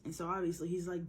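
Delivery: plain reading voice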